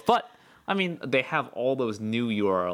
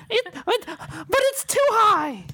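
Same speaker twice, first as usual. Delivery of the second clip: high, slow mock granny squeak; old lady voice; 5 dB louder, slower than usual. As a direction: imitate a flustered old lady. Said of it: "you sound like an old lady".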